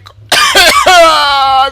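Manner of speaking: long, high, loud scream; panicked scream. Real, not acted. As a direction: scream while running from danger. Screaming while fleeing in game.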